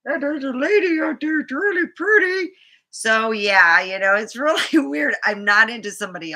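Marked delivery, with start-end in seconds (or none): 0.1-2.5 put-on high childlike voice; higher mimicked daughter voice; little girl voice. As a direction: imitate a young daughter reporting. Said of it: "the older daughter comes back home going".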